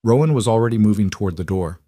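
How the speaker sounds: usual speaking voice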